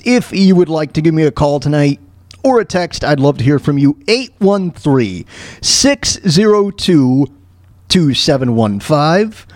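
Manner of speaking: normal talking voice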